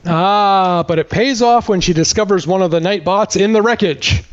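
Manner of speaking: ordinary talk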